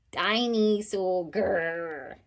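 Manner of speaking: slow, drawn-out little dinosaur cry; mild playful shout with a growl; toddler's roar. Acted. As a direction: growl like a small child playing dinosaur. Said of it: "shouted George".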